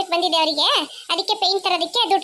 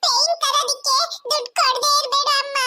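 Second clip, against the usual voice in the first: put-on high, whimpering little-girl voice; acted crying, pitched high; tearful child pleading; 5 semitones higher than usual. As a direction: plead with mother through put-on sobs. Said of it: "ಯಾಕ್ ಅಳ್ತಿದ್ಯಾ?".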